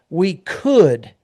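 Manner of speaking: could set apart with a pause after; stress on could. Retold or imitated. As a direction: lean into could to flag doubt. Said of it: "and I emphasize the word could".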